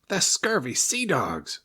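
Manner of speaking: high, slow angry sea serpent snarl. A narrator's reading. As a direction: spit out the insult. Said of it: "snarled the Serpent".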